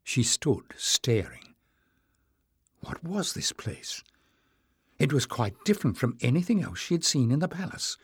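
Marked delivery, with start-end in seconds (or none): 2.8-4.0 soft, awed question; hushed wonder. Acted. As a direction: ask it under your breath, amazed. Jasmine's hushed inner question.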